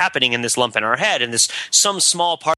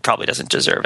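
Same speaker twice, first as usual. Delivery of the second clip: low whisper; 11 semitones lower than usual. Shown as a demonstration. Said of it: "mental faculty that goes into saying".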